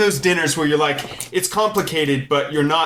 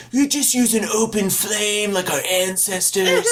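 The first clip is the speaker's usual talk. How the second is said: higher mock caveman purist voice; old-fashioned purist; 7 semitones higher than usual. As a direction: play a smug back-to-basics type. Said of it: "I sound like some".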